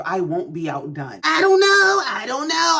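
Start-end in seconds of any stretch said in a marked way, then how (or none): 1.2-2.8 high, loud protest of uncertainty; emphatic disclaimer. Real, not acted. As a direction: throw it out high and loud, then ease off. Exclaims doubt after her verdict.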